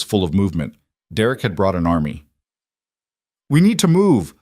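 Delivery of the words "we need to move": loud, high urgent shout. A narrator's reading. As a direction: yell the order over the chaos. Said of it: "Marco shouted".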